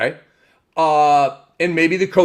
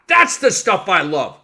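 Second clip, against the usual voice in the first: normal talking voice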